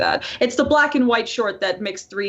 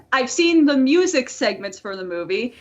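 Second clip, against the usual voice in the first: ordinary talk, nothing marked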